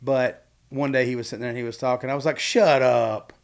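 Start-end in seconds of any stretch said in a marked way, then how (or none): 2.4-3.3 drawn-out, punchy "Shut up"; playful mock-incredulous exclamation; joking disbelief. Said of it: "I was meaning as, like, a joke".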